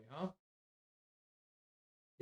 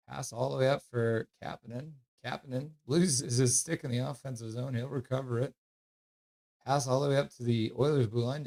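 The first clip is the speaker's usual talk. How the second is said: casual live call of the game; hockey play-by-play; 6 dB louder than usual. Acted. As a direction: narrate the play like a relaxed announcer.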